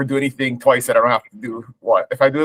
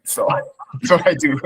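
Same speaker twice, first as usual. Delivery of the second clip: laughing; 3 semitones higher than usual. Real, not acted. Laughing while telling his hobby.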